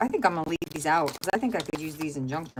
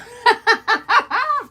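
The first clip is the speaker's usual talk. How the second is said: burst of laughter; 12 dB louder than usual. Real, not acted. Laughing at herself to viewers.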